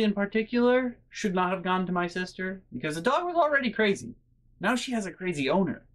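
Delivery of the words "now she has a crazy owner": usual speaking voice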